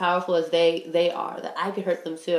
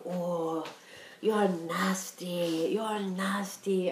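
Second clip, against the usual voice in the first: slow, soft imitation of mom's blunt verdict; imitating mom; 6 dB quieter, much slower than usual. Mom describing her daughter's anger, slow and soft. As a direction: drawl it out quietly like mom. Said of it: "She's just like".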